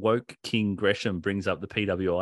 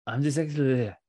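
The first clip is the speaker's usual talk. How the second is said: mimicking a mumble; 4 semitones higher, 4 dB louder than usual. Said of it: "'Cause he's like".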